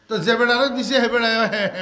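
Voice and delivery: ordinary delivery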